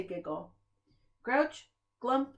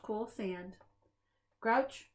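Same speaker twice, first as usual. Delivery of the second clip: ordinary delivery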